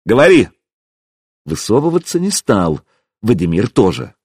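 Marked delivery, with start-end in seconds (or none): 0.0-0.5 raised command; shouting back. A narrator's reading. Hero telling the prince to talk, shouting back. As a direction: call it out across the yard. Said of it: "крикнул я в ответ".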